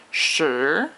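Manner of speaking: "shi" drawn out and rising; long second tone. Shown as a demonstration. Teacher showing a longer tone, rising "shi". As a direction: stretch "shi" with a climbing pitch. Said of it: "are both longer tones".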